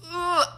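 high pained ugh; disgusted ugh groan. Retold or imitated. Recalling chewing noise, a sharp high groan of disgust. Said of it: "I'm just like".

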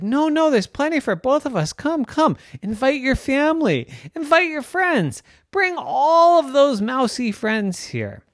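high, loud sing-song cat voice; eager cat coaxing. Acted. Cheddar invites the mouse's whole family, high and eager. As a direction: coax them in with a bright squeal.

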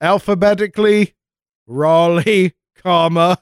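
town crier. Retold or imitated. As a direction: announce it like a herald. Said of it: "Hear ye, hear ye".

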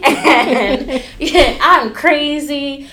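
loud, high, laugh-filled words. Real, not acted. Stylist admitting she nearly quit, laughing. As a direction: laugh at yourself as you talk, drawing it out slowly.

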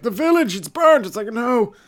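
high, loud mock wail of despair; a hammed-up high lament over the burnt crop; wailing villager. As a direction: ham up the grieving villager.

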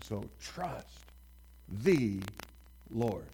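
pause, hit "the", pause, then "Lord"; "the" lifted out alone; stress on the. Shown as a demonstration. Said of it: "emphasis is on who".

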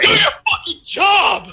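high mock yell with laughter in it; hammed-up commander's shout; shouted order. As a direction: holler it at shirking soldiers. Acts out Erwin yelling orders.

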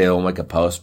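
ordinary delivery

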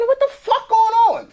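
high, incredulous outburst; stunned disbelief. Retold or imitated. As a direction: act floored by what you see. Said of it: "I'm like".

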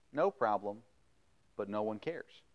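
normal talking voice

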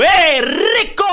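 high, slow drawn-out moan; hammed-up moan. Acted moan in bed.

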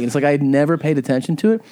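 ordinary talk

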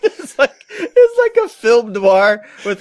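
slow, laughing build-up to the joke. Real, not acted. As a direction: laugh through the words while setting up the bit. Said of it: "laughing set-up".

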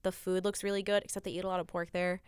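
usual speaking voice

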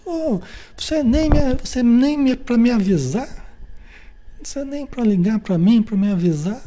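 high, indignant complaining; whiny complaint. Retold or imitated. Someone who missed an appointment blaming the friend, high and whiny. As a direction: blame the other with a whine. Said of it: "Aí depois você reclama assim".

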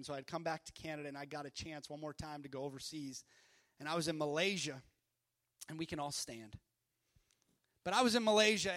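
ordinary delivery

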